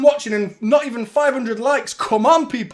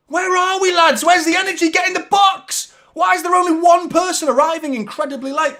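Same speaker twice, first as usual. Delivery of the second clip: higher, raised fan rant at the team; frustrated yelling; 6 semitones higher than usual. Real, not acted. Fan shouting at his team for arriving late, frustrated. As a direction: demand effort, yelling. Fan berates team's lack of energy.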